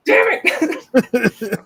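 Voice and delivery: high, loud "damn it" outburst; squeaky mock curse; mock frustrated yell.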